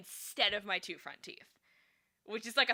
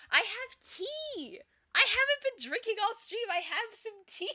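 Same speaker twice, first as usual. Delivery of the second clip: excited squeal; 13 semitones higher, 7 dB louder than usual. Real, not acted. Excited about her tea.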